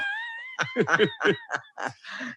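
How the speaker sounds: high-pitched peal of laughter; laughing. Real, not acted. Amused at own joke about crying too, laughing.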